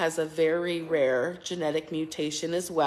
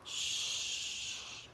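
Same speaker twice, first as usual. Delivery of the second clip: rhythmic shushing; 8 dB quieter, slower than usual. Real, not acted. Hushing baby to sleep.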